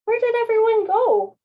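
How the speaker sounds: high, loud, puzzled little cloud; a small cloud calling out, lost; wondering little cloud. Acted. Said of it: "wondered the little fluffy cloud".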